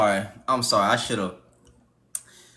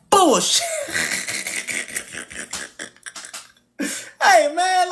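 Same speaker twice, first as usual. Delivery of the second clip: mock crying; 14 semitones higher, slower than usual.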